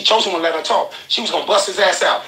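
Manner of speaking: normal talking voice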